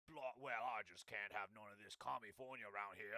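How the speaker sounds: lightly put-on British accent, mocking; a hint of a posh British politician; mock Steve Hilton accent. Mocking a politician in a light British accent. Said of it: "Steve Hilton being like".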